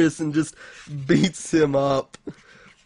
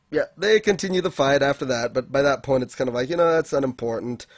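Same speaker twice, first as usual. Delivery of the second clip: normal talking voice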